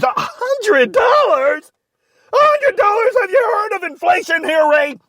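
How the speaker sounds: outraged shout. Acted. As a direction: scream the price back in disbelief. Boss outraged at the price.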